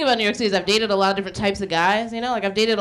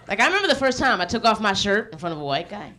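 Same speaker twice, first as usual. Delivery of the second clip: ordinary delivery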